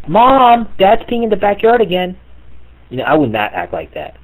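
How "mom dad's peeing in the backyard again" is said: put-on high, loud tattling child's voice; kid tattling. Kid shouting to mom about dad. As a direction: squeal it like a kid telling on dad.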